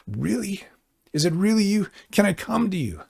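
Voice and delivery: high, hopeful disbelief; we like Peter, amazed and unsure; disbelieving. Retold or imitated. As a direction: ask as if it can't be true. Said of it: "we're like Peter".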